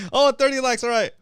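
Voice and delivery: bright, high burst of delight; sudden happy surprise at the count; excited. Real, not acted. Likes goal suddenly reached.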